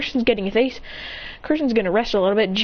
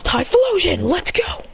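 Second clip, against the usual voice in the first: higher-pitched rallying call; battle send-out call; 7 semitones higher, slower than usual. Acted. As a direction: call your partner into battle. Trainer sends out his partner.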